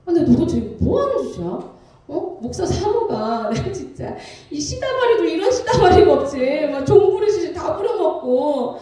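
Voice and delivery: hammed-up fed-up venting; retold anger. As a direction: replay a fuming grumble to oneself. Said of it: "화가 막 치고 치밀어 오르는".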